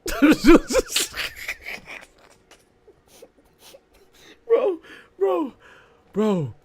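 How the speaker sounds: high, loud words through laughter; real helpless laughter; crying laughing. Real, not acted. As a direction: crack up mid-sentence. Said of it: "That had me crying".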